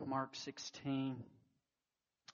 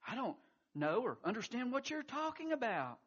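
hasty denial; 10 semitones higher, 6 dB louder than usual. Acted. Peter denies knowing Jesus.

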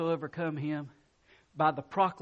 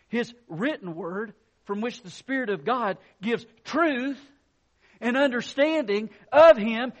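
loud, raised sermon proclamation; preaching at full force; 5 semitones higher, 6 dB louder than usual. Real, not acted. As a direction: drive it home to the congregation.

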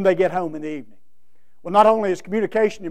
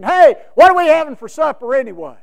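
loud call from the easy chair; yelling to the kitchen; 5 semitones higher, 8 dB louder than usual. Retold or imitated. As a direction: holler it into the kitchen like a tired husband. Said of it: "yells into the kitchen".

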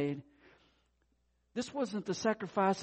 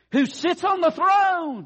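much higher, louder and slow; loud slow call; 10 semitones higher, 5 dB louder, slower than usual. Shown as a demonstration. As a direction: proclaim it slowly for them to repeat. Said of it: "I'm gonna say it loud".

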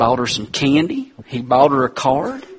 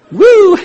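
laughing whoop; 10 dB louder than usual. Real, not acted. Laughing at the watch joke.

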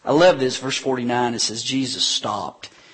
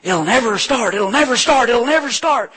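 rising, louder mocking chant; higher and louder mock chant; jeering crowd; 10 semitones higher, 7 dB louder than usual. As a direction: taunt it like scoffers on the riverbank. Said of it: "they're like".

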